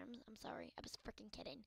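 whispered apology, mildly played. Ball character whispering sorry to the player. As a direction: hush it like a sheepish confession. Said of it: "He's like".

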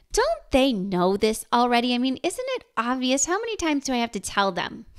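quick, incredulous mini-rant; mock exasperation. Mother thinking about her little children, mock exasperated. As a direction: rattle off the questions in exasperated disbelief.

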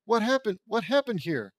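high-pitched, alarmed questioning; shocked. A narrator's reading. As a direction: ask it in rising disbelief.

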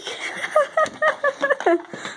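shrieking burst of laughter; high squealing laugh. Real, not acted. Big laugh at flip.

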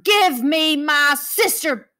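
Lucy roaring at the monsters for Mia. Acted. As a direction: roar to get your sister back. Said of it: "Lucy roared".

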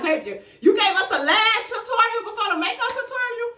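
high, loud mock complaint with laughter; incredulous viewers. Vlogger voicing viewers asking about the tutorial order, mock incredulous. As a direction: imitate baffled fans in a soaring voice. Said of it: "I know y'all probably like".

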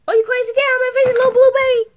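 high, very loud furious yell; furious shout. Acted. Girlfriend screaming at her partner to get out, furious.

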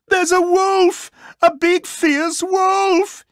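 very high, loud, drawn-out alarm cry; hammed-up high-pitched yell of panic; panicked shout. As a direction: shout the alarm in a squealing pig voice. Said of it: "he shouted".

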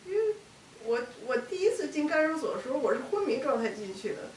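high, loud, agitated outpouring; upset and pushing the words out loudly; real distress. Real, not acted. Distressed account of waking in pain.

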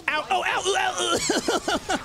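high pained yelps; yelping. Real, not acted. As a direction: wince through a crash. Crashing again.

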